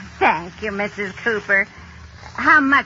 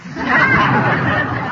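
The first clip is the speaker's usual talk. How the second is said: laugh; 12 semitones higher, 5 dB louder than usual. Acted. [name] laughs.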